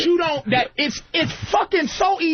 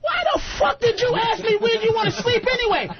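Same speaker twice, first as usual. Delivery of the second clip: mock angry retort; 11 semitones higher than usual. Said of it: "And so I go".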